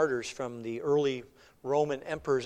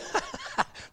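high mock laugh; scoffing laugh. Apostles hearing the Sanhedrin's death threat, scoffing laugh.

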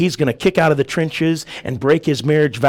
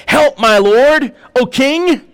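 high, raised plea to a king; crying out; 7 semitones higher, 5 dB louder, slower than usual. A narrator's reading. Woman cries to the passing king, crying out. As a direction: call out as the pleading woman. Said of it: "there cried a woman unto him".

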